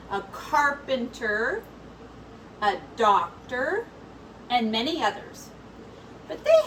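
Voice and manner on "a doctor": ordinary delivery, nothing marked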